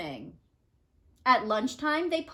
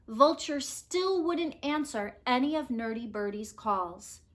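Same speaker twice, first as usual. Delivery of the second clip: usual speaking voice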